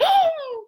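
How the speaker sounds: high sudden gasp of shock; retold high gasp; sharp gasp. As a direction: gasp of realization.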